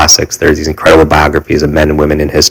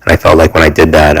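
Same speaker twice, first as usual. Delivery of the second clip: usual speaking voice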